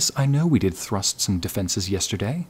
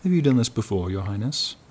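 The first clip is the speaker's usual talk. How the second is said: ordinary talk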